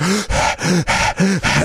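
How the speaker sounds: imitated winded gasps after a rant; mock panting, high and heaving; out-of-breath gasping. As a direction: gasp for air as if ranted out. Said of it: "he gets out of breath from his own rant".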